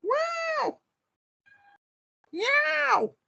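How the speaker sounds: very high squeal; squeaky wow. Pretend. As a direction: yelp it way up high. Squeaky exclamations.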